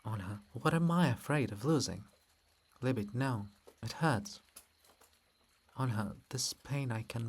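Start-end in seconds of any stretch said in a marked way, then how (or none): none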